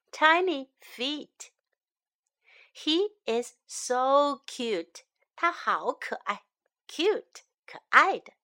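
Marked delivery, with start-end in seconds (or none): none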